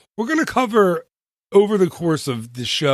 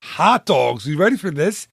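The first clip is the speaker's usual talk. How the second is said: normal talking voice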